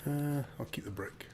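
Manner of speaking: hushed whisper about a gun. Real, not acted. Gamer sneaking past enemies, whispering to teammate. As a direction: whisper so the enemy won't hear. Quiet remark mid game.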